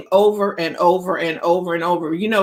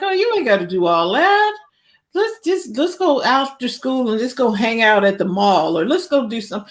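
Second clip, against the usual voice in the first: high, coaxing lazy friend; tempting slacker voice; 3 semitones higher than usual. Pretend. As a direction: lure them off to the mall. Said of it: "the other voice. It's like".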